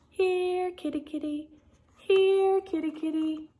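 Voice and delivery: put-on high, sad little girl's call. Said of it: "a little girl's sad voice calling".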